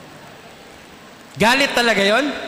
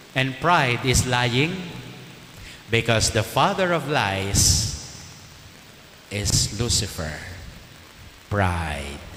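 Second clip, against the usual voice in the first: slowing right down, dropping much lower to a grave Pride; slow grave emphasis; 5 semitones lower, 4 dB quieter, much slower than usual. Acted. Priest warning the congregation about pride, slow and grave. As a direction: let each word sink, slower and lower.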